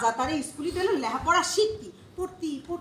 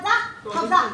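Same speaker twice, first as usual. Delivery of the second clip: normal talking voice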